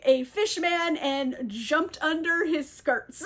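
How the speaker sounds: ordinary delivery, nothing marked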